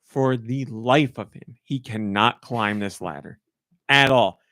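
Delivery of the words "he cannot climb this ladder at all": ordinary delivery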